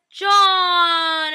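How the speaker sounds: high, loud, drawn-out wail; dismayed cry. Retold or imitated. Fan watching John fall dead, dismayed wail. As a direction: cry out the name in hammed-up grief. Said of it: "And I was so sad".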